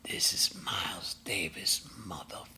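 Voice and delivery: low hoarse whispered rasp, trailing off. Retold or imitated. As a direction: growl it in a raspy whisper. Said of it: "and I said".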